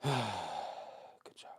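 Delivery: hushed good job; whispering. Real, not acted. Whispered praise to dog.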